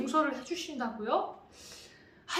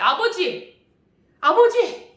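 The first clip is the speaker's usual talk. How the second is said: louder, higher indignant cries of Father; angry calling; 5 semitones higher, 8 dB louder, slower than usual. Acted. Older son angrily calling out to his father in complaint. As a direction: call him out in a rising temper. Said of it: "화까지 내기 시작했어요".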